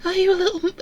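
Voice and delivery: very high falsetto little voice; girl voice. A narrator's reading. Narrator attempting Eleanor's question to Sarah, tinny girl voice.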